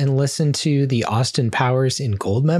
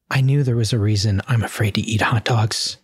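ordinary talk, nothing marked

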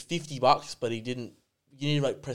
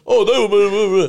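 higher, much louder mock whine; mocking imitation; 9 semitones higher, 10 dB louder than usual. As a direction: parody the customer's ranting. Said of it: "You screamed at me saying".

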